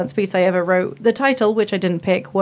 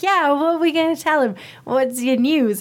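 eager; 6 semitones higher than usual. Retold or imitated. Eager player awaiting confession.

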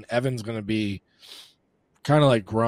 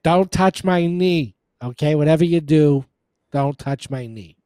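put-on teasing Boston accent, slow and loud at first; mock Boston accent; 4 semitones higher, 6 dB louder than usual. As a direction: imitate your buddy in a hammed-up Boston accent. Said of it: "have a Boston accent".

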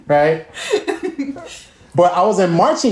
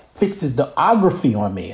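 ordinary delivery

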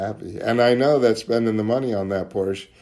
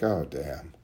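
sheepish realization; 8 semitones lower, 10 dB quieter than usual. Retold or imitated. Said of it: "And I'm like".

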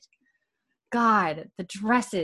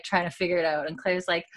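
usual speaking voice

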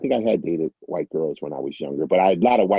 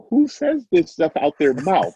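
higher and louder, incredulous; stunned rhetorical question, pitched way up; disbelieving outburst; 9 semitones higher, 4 dB louder than usual. Retold or imitated. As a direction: ask it like you can't believe anyone would say that. Retelling disbelief at her remark.

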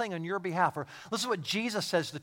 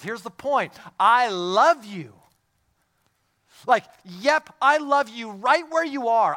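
high, loud, impassioned sermon delivery; urgent, raised pulpit voice; full-force preaching; 6 semitones higher, 9 dB louder than usual. Acted. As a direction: preach it out loud with heavy emphasis.

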